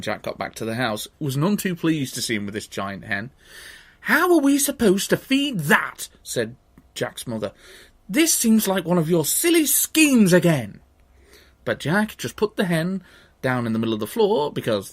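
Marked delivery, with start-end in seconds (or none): 4.0-6.1 high-pitched indignant mother; none too pleased. A narrator's reading. Mother to Jack about the giant hen, indignant. 8.1-10.8 high, loud exasperation; scolding mother. A narrator's reading. Mother to Jack about his silly schemes, exasperated scolding.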